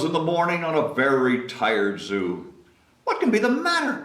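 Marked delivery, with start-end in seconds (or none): 3.2-4.0 a fussing zookeeper; worried keeper. Acted. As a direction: cry it out in alarm. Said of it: "cried the keeper".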